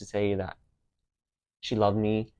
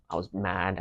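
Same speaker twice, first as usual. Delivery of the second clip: ordinary delivery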